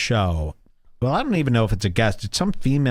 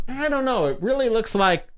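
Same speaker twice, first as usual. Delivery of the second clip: high-pitched mock hesitation; playfully acted reluctance; retold doubt; 10 semitones higher than usual. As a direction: voice up high, dubious. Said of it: "And I go".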